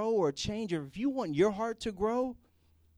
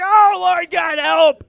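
full-throated shouted prayer, much higher and louder; hammed-up desperate wailing prayer to God; yelling prayer; 10 semitones higher, 17 dB louder than usual. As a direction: cry out to God at the top of your lungs. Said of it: "it seems like they're yelling when they're praying".

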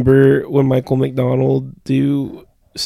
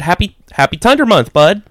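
cashier; 7 semitones higher, 5 dB louder than usual. Retold or imitated. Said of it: "And he said".

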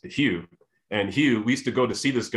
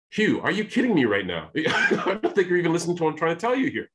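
higher, laughing exasperation; a half-laughing retold protest; laughing disbelief; 8 semitones higher than usual.

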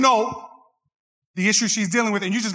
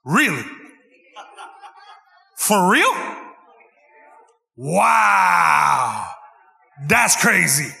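the same stock replies, louder, hammed-up; louder mock wow-and-crazy chant; louder call-along; much slower than usual. Shown as a demonstration. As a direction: lead the crowd in fake interest, loud. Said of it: "Come on, do it with me".

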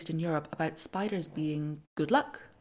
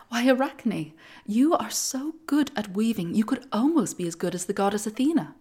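louder, eager admiring praise; admiring villager; 5 semitones higher, 7 dB louder than usual. Acted. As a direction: praise her like an awed villager. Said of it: "someone happened to say".

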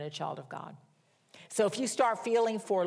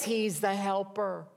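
ordinary talk

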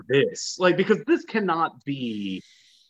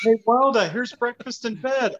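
high, sing-out mock sweetness; cheery serving partner; 9 semitones higher than usual. Partner serving breakfast in bed, mock cheery. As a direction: present it with pitched-up, put-on cheer.

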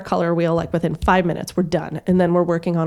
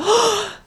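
high excited gasp; a happy sharp intake of breath; delighted gasp. Retold or imitated. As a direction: gasp with sudden joy. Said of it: "she goes".

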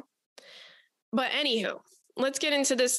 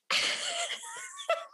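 high burst of laughter; 6 dB quieter than usual. Real, not acted. Host cracks up at her own Houston joke, shrieking laugh.

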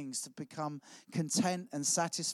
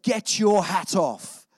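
loud, slow, drawn-out mock shout; shouted order; 3 semitones higher, 9 dB louder, much slower than usual. Church doorman to a newcomer in a cap, shouting. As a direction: shout it as a stern command, each word stretched. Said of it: "I've just been shouted at".

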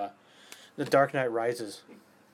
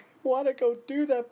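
put-on high-pitched, louder childlike eagerness; excited inner kid; 12 semitones higher, 5 dB louder than usual. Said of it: "I'm dying inside because I'm like".

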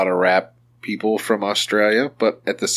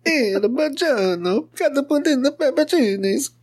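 put-on old Italian lady. Host teasing guest with his co-host's nonna impression, over-the-top old Italian lady. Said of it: "like, an old Italian lady impression".

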